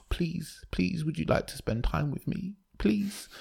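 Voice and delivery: high soft begging voice; meek pleading. Retold or imitated. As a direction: plead like a timid puppy. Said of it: "That's like meekly going".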